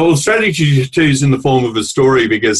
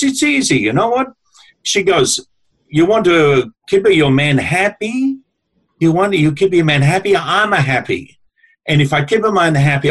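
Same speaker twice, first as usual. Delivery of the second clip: lightly voiced Italian grandmother; 3 semitones higher than usual. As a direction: play the little Italian wife letting you in on a secret, a light Italian lilt. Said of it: "wide Italian woman in their seventies".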